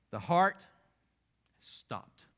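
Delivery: loud on heart, then a breathed stopped; pause, then drop to a breath; dramatic pause. Acted.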